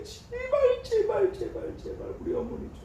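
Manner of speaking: high, pleading cry of prayer, then fading away; begging God for his mother's life; a retold plea that rises high and collapses to a hush; desperate pleading.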